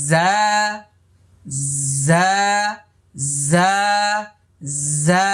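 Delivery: জা with teeth together, not too hard; gentle buzzing জা demo; Arabic zay. Shown as a demonstration. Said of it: "আমার সাথে সাথে বলুন".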